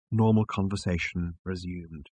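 ordinary delivery

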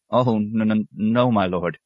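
flustered novice tripping on the N; helpless stammer. A narrator's reading. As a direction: stumble timidly over the no. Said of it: "The novice stammered helplessly".

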